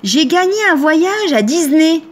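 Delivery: high, gushing mock of a happy customer; excited client. Client announcing her prize to the shop owner, excited. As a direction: mimic a delighted client, stretching out the end. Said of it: "il y a une cliente, elle me dit".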